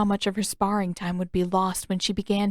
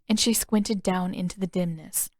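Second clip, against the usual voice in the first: ordinary delivery, nothing marked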